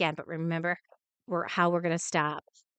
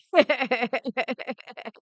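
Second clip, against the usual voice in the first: laughing; 5 semitones higher than usual. Real, not acted. Laughs at Beth's answer.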